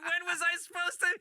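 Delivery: high-pitched, cracking up while protesting; laughing indignation. Real, not acted. Comedian retelling his retort to the cop, laughing. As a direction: push the comeback out through real laughter. Laughing through comeback after punchline.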